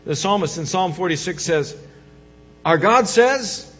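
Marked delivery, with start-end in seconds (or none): 2.6-3.7 slow proclaiming. Acted. Preacher quoting God slowly.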